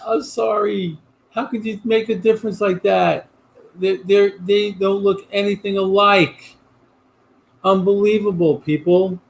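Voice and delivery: can't believe the mix-up; incredulous. Real, not acted.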